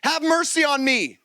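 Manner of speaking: high pleading call. A narrator's reading. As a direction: call out over the crowd. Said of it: "he shouted all the louder".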